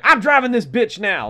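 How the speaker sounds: loud, high mock boast; puffed-up pride. Old man imagined taking over from the bus driver, proud boast. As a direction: announce it like you own the bus. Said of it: "that kind of pride".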